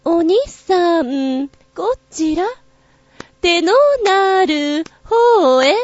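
slow, high, coy Kyoto courtesan call. Shown as a demonstration. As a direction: beckon a customer slowly and sweetly. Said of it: "なんかね、こんな感じだった".

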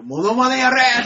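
high, loud mock yell with laughter; imitated shout. Co-host echoing the yelled order in amusement, laughing mock shout. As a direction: mimic a colleague's yelled order. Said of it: "って叫ばれる".

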